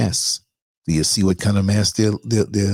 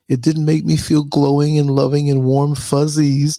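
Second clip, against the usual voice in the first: whiny complainer voice; 8 semitones higher, 4 dB louder than usual. Retold or imitated. Mocking an offended viewer.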